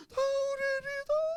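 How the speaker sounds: very high, loud tearful answer; mock wailing kid; imitated crying child. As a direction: answer father through childish sobs. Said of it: "Sambil nangis".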